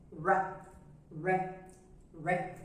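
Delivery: "R" said as an English r, not the Portuguese rá. Shown as a demonstration. Teacher showing students the strong English "R". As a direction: curl the tongue for a strong English "R". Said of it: "fazermos um som de R".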